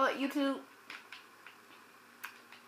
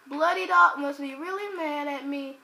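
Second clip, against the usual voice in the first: ordinary talk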